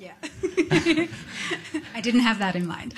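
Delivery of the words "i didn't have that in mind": amused admission through laughter; laughing. Real, not acted. Musician owns up to the interviewer about an unplanned loop, laughing. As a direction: say it while breaking into a laugh. Admits it amid shared laughter.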